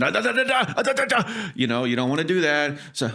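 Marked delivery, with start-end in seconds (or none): 0.0-1.2 high, mock infomercial babble; mock infomercial host rattling off nonsense; infomercial pitchman. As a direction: ham up a cheesy TV pitch. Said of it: "act like an infomercial person".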